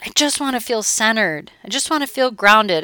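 slow, longing client voice; a hint of a yearning client; wistful client. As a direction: drag out each wish like a dreamy client. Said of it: "I'll have people say that to me".

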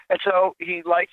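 ordinary talk, nothing marked

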